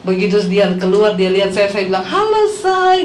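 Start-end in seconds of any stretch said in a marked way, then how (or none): 2.1-3.0 high, sing-out sweetheart greeting; coy greeting. Retold or imitated. Wife surprising husband with hair extensions, coy high greeting. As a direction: imitate a wife sweetly showing herself off.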